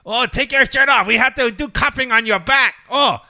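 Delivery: put-on high, loud caricatured Chinese accent; mock Chinese accent. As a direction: exclaim like a caricatured Chinese clinic man. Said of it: "the Chinese guy was like".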